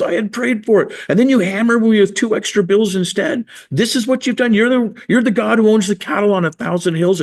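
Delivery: indignant prayer, high and insistent; retold furious rant. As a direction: hurl the complaint at God in a high strained voice. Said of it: "I'm furious with him".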